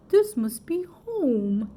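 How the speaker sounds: slow tender bear. A narrator's reading. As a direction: savour each word. Bear voiced in story.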